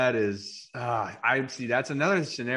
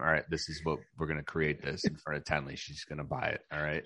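low, hushed scheming voice, much softer; conspiratorial plotting; 8 semitones lower, 9 dB quieter than usual. Pretend. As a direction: plot it quietly so she won't hear. Plotting secretly with friends.

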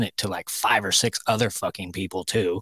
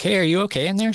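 higher concerned call through a door; 11 semitones higher, 4 dB louder, faster than usual. Retold or imitated. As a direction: ask through the bathroom door, raised and worried. Retold calling through bathroom door.

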